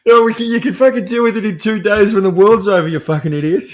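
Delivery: loud, high exasperated outburst at a character; exasperated yell. Real, not acted. Reviewer yelling at a film character, loud and exasperated. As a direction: vent at the screen like they can hear you.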